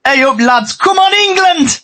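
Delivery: high, slow Yorkshire cheer; hey up lads in broad Yorkshire; Yorkshire accent. Acted. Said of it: "say this in the Yorkshire way".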